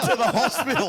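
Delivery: breaks into high laughter through the words; can't hold the lie, laughing. Real, not acted. Laughing mid fake excuse.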